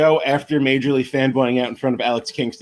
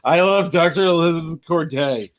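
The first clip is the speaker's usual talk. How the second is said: gibbering fan; 3 semitones higher, slower than usual. Retold or imitated. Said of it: "I just turned into a gibbering fifteen-year-old".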